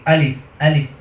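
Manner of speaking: "আলিফ" with a soft, lax first vowel; slack, everyday "আলিফ" as a mistake; soft wrong alif. Shown as a demonstration. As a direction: say "আলিফ" casually, no glottal punch. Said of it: "যাতে নরম না হয়".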